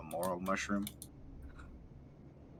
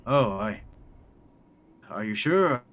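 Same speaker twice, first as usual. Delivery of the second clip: high, loud hopeful voice; 6 semitones higher, 10 dB louder than usual. Acted. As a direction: plead with eager hope. Moon tracker NPC voice.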